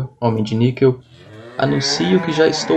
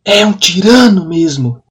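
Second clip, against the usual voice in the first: higher, louder, slow angry exclamation; angry outburst; 5 semitones higher, 6 dB louder than usual. Acted. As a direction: denounce him with outrage. Outburst against villain.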